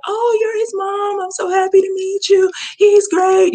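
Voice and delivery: put-on high-pitched eager young girl; very polite girl. Young girl meeting her friend's mom, eagerly polite. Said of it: "She was very polite".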